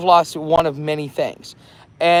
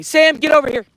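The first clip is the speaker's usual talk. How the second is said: loud, high call to a dog; 11 semitones higher, 5 dB louder than usual. Real, not acted. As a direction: call the puppy over sharply. Calling the dog back.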